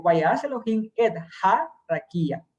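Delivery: small accent on "ha", slight break before "rakia"; acento en "ha". Shown as a demonstration. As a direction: read the verse, holding "ha" a moment before "rakia". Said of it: "Hace un pequeño acento en ja". (pequeño acento y pausa en "ha")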